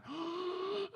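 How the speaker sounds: long, soft mock gasp; shocked audience gasp. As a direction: gasp like a scandalized crowd.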